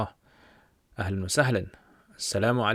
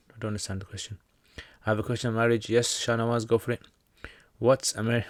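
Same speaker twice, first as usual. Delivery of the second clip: usual speaking voice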